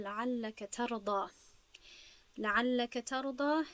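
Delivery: slow, measured recitation of قال تعالی لعلک ترضا; Quranic recitation. Shown as a demonstration. Teacher recites a Quran verse to the class, slow and measured. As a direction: intone the verse slowly, as scripture. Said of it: "خب، اینجا نظر کنید".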